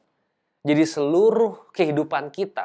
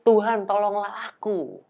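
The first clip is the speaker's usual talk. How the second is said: mild cry for help; 5 semitones higher, slower than usual. A narrator's reading. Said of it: "Lalu berteriak".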